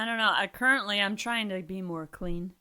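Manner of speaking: normal talking voice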